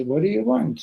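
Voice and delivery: high, loud mock exasperation; exasperated. Interrupted drinkers answer the young rabbi, exasperated. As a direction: snap back at the interrupter, hammed up.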